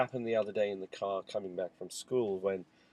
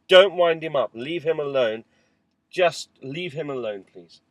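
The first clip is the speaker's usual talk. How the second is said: loud, firm warning to stop the teasing; father in the car shouting at older son to stop winding up his brother; 5 semitones higher, 12 dB louder than usual. Retold or imitated. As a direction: shout at him to leave his brother alone.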